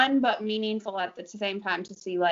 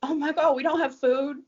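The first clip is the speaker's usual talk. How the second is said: rising, louder mock panic; retold panic; 5 semitones higher, 6 dB louder than usual. Hurricane morning at the hotel, mock panicked rising cry. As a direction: build the panic up to a near shout. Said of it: "we're like".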